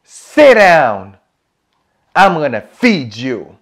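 loud Southern order to the table; bossy Southern cook calling out; Southern accent. Acted. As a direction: holler it in a put-on Southern accent. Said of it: "that's my southern accent".

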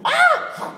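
sudden high-pitched yelp of pain. Acted. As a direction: yelp like a clawed wolf. Wolf yelps when clawed.

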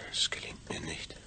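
breathy whisper, giving up; barely voiced admission of failure. Man whispering to himself that it fails. Brain in vat, thinking alone.

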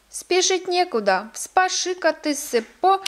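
put-on high, loud old-woman character voice; bossy old mistress. Old mistress of Pohjola setting the suitor a task, bossy. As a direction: pitch it high and order him about.

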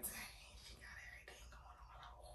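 whisper. Retold or imitated. Retold thought about stylist's drama.

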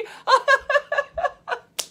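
loud, high burst of laughter; laughing. Real, not acted. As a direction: crack up helplessly. Cracks up at foofy coffee.

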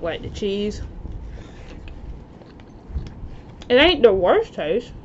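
words between bites; talking while chewing. Real, not acted. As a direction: mouth full of pizza. Seen 1: Bites at -5; puffed chewing cheeks at 4.3, 5.1, 9.8, 10.6.

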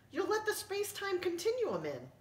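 high, alarmed alien warning; panicked alien. Acted. Alien warns the narrator about the door, high panicked voice. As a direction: squeal out the danger in a high voice.

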